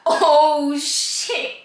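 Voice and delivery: drawn-out "oh", then drop to a hush; shocked reaction. Retold or imitated. Everyone reacting to the wig coming off, shocked. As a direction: imitate a whole room's stunned dismay.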